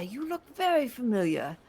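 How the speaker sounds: put-on older Dragonborn shopkeeper welcomes the party into his shop. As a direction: greet them like a doting old merchant. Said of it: "older Dragonborn".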